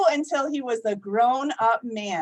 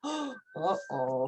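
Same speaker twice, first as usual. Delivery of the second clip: mock alarm; 5 semitones higher, 7 dB quieter than usual. Real, not acted. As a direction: gasp then soft uh-oh. Reacting to the story.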